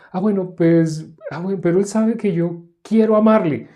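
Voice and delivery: high, pleading excuse in a put-on saintly voice; a self-righteous believer backpedaling in a high voice; pious high voice. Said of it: "se pone aguda, aguda, aguda".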